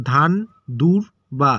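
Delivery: ধান দুর্বা in three separate syllables; broken into syllables. Shown as a demonstration. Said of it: "এটা ভেঙে ভেঙে".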